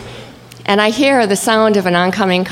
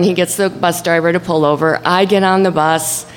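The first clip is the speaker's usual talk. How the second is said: normal talking voice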